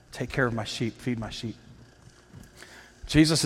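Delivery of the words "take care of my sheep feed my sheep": soft gentle command. Acted. Jesus answers Peter softly.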